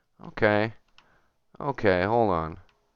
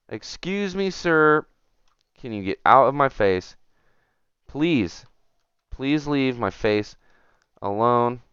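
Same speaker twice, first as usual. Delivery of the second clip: mock polite annoyance; 4 semitones higher, 3 dB louder than usual. Shooing someone off his face.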